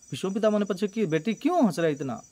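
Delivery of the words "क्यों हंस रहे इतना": normal talking voice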